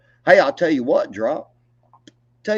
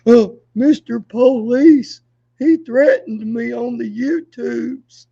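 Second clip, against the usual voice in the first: whiny slow mock tattling to police, higher; mock crybaby; 5 semitones higher, 7 dB louder, slower than usual. Mocking the man as a crybaby snitching to police, whiny and slow. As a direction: whimper like a snitch to the cops, slowly.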